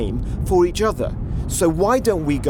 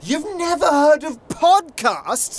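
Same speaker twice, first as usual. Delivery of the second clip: incredulous; 11 semitones higher, much slower than usual. Acted. Shocked at ancient Roman.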